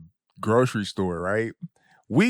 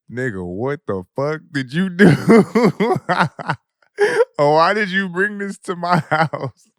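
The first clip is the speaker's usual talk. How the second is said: mock outraged; 7 semitones higher, 6 dB louder than usual. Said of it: "looking my partner dead in his face like".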